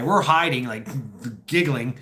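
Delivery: usual speaking voice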